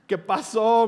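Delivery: a sudden loud, high, frightened "¿Qué pasó?"; alarmed answering of the phone; startled alarm. Retold or imitated. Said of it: "Me asusté".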